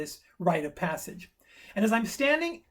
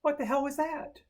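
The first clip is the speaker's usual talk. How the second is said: higher-pitched bewildered aside; baffled self-talk; 7 semitones higher than usual. Retold or imitated. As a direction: ask yourself in mild disbelief. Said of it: "I said out loud to myself".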